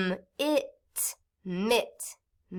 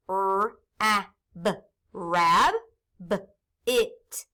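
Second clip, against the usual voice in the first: slow, segmented sounding out of each part; much slower than usual. Shown as a demonstration. Teacher sounding out rabbit for students, slowly. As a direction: stretch each syllable so kids can blend. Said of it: "So I can sound it out now".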